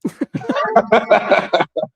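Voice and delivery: high, rapid run of laughter; laughing. Real, not acted. Host amused by his inner beauty remark, high laughter. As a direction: laugh at your own joke.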